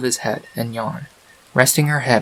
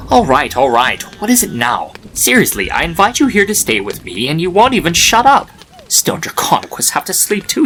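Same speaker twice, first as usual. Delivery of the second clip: higher, louder exasperated rant; annoyed statue voice, raised and loud; annoyed outburst; 6 semitones higher, 5 dB louder than usual. Acted. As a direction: scold the crying foal in exasperation. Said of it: "the voice seemed annoyed".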